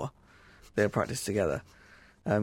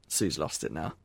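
usual speaking voice

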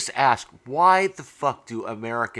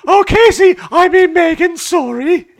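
loud, high mock-regal falsetto; the Queen; 11 dB louder, slower than usual. The Queen muddling Meghan with Casey, hammed-up high royal voice. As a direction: royal parody on the edge of laughter. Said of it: "I'm being the queen".

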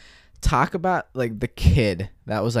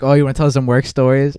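loud eager question, pitched up; excited; 5 semitones higher, 9 dB louder than usual. Real, not acted. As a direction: jump in eagerly. Eager to hear work stories.

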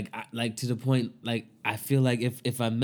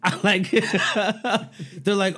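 ordinary delivery

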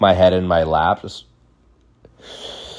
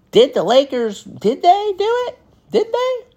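squeaky high voice, desperate to know; anxious excitement. Retold or imitated. Kid reading the paper for the Lakers score, squeaking higher and higher. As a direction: pitch shooting up with suspense.

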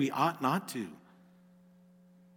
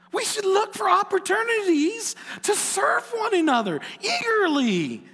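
high, ringing exhortation; impassioned preaching; 12 semitones higher than usual. Real, not acted. Preacher urging his congregation to serve one another, impassioned. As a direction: preach it with rising fervor.

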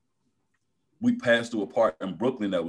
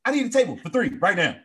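much higher, laughing fake panic; mock frantic customer; 3 dB louder, faster than usual. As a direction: beg for a table in hammed-up alarm. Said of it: "run in that joint and be like".

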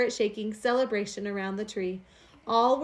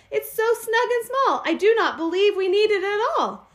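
higher, louder protesting wife; fussy wife; 9 semitones higher, 6 dB louder than usual. A narrator's reading. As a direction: object like a worried little housewife. Wife objects to the tree.

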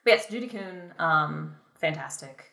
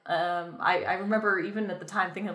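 normal talking voice

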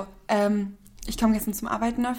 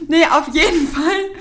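giggling, slowed and higher; laughing through words; 6 semitones higher, 7 dB louder than usual. Real, not acted. Still laughing while resuming the story, slow and higher. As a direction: laugh while getting back on track.

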